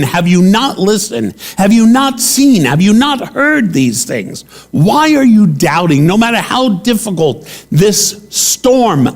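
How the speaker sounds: imagined scolding from Jesus; mock yell. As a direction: voice the rebuke Jesus did not give, half-shouted. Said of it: "not to yell at them and say".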